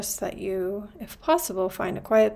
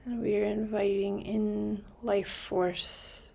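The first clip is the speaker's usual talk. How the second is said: calm, spacious delivery, letting each phrase settle; guided meditation; 4 dB quieter than usual. Real, not acted. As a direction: gently guide listeners into stillness, unhurried.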